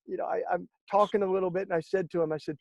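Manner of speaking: usual speaking voice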